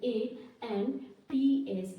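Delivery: each letter stretched out slowly; slow dictation for writing down; slow spelling. Shown as a demonstration. Teacher spells letters for class.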